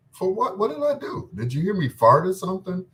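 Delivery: high, incredulous retold protest; what did I do, mock-shocked; mock surprise. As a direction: play dumb, genuinely baffled. Retelling own puzzled reply.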